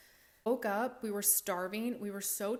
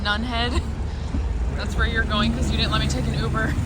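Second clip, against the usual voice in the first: shouting; 2 semitones higher, 14 dB louder than usual. Real, not acted. Shouting to someone off camera.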